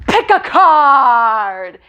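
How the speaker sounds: very slow, loud pushy pick a card; dad thrusting a card trick at his kid, aggressive. Retold or imitated. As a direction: shove it in the kid's face, drawn out. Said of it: "super aggressively in my face".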